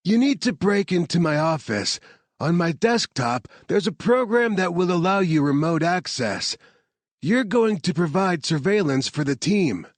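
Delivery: a hint of urgent instruction; lightly voiced Harvard. A narrator's reading. Harvard telling Sammy to break into his office, urgent. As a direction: engineer giving orders down the phone, lightly voiced.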